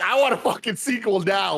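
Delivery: high-pitched, excited declaration; hyped-up demand. Acted. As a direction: declare it like a suddenly converted fan. Sudden hyped change of mind.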